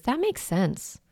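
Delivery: soft steady That makes sense; calm reassurance. Shown as a demonstration. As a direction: say That makes sense quietly and evenly. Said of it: "It's one of my favorite phrases".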